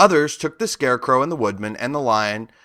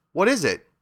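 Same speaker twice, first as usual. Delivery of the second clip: a touch of surprise, higher; lightly surprised little girl asking; 8 semitones higher than usual. A narrator's reading. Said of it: "answered Dorothy in surprise".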